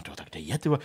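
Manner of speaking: baffled aside under the breath; puzzled muttering to himself. Retold or imitated. Retells his puzzled thought while searching.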